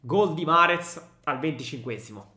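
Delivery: breathless italian goal commentary. Acted. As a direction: shout the attack then drop. Play-by-play of the goal.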